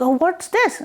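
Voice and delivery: high, wondering question; curious goddess. Acted. Parvati asking Shivji about the cow, high and curious. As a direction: ask it with childlike wonder. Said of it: "she's like".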